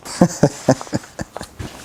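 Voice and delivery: tag. Real, not acted. Instructions absurd.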